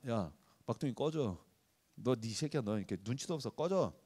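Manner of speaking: soft, low dismissive grumble; mock older brother. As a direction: brush the kid off with lazy contempt. Older brother brushing off youngest. (막내를 귀찮아하는 형, 낮고 작게 툭 내뱉듯)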